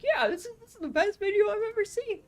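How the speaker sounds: very high, loud fan voice; over-the-top imaginary fan praise; gushing viewer. Acted. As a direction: gush in a squeaky-high put-on voice. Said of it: "transform into, like, a viewer".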